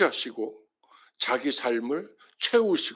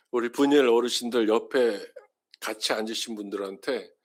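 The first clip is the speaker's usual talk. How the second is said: ordinary delivery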